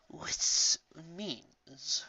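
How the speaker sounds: soft, breathy, barely voiced; whispered resolve; hushed whisper. A narrator's reading. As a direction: drop to a whisper for the key point.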